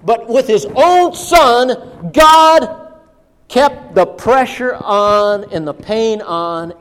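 loud, emphatic sermon climax; booming preacher's delivery; forceful preaching. Real, not acted. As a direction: hammer each phrase to the congregation.

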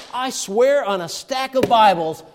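a preacher voicing Peter swearing an oath; mock oath. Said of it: "He denied it with an oath".

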